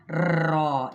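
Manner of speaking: रॉ with too much rolling; wrong trilled ra. Shown as a demonstration. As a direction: trill the र in रॉ too long, as a mistake. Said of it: "ये गलत है". (रॉ को ज़्यादा तकरार के साथ गलत बोलना)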